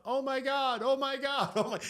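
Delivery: put-on high excited kids' shouts; high shrieking kid voices; kids yelling. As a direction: mimic neighborhood kids screaming in joy. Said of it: "kids from around the neighborhood start yelling out".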